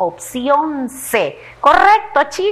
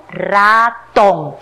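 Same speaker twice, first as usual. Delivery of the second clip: "ratón" lento, sílaba por sílaba: ra, tón; separado en sílabas; 2 semitones lower, 4 dB louder than usual. Shown as a demonstration. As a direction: split "ratón" into two stretched, firm syllables. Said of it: "luego vamos a separar en sílabas". ("ratón" dicho despacio, separando ra y tón)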